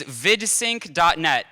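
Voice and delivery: slow. Real, not acted. Spelling out winning domain name.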